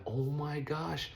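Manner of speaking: high, slow drawn-out dismay; dismayed oh my gosh. Retold or imitated. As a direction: stretch it out in disbelief. Car comes back damaged.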